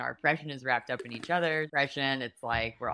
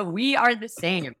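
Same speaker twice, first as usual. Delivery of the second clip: higher and louder, pleading self-defense; exasperated protest; 7 semitones higher, 5 dB louder, slower than usual. Retold or imitated. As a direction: insist you're one of them, voice up and loud. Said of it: "I was like".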